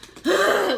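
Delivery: heavy sigh, breath out; demo sigh. Shown as a demonstration. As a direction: sigh like you're frustrated. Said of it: "And I sigh a lot".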